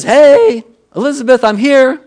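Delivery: high, loud mock arrival call; calling out a greeting. Mary announcing herself to her cousin Elizabeth, calling out high. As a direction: sing out a big hello on arriving.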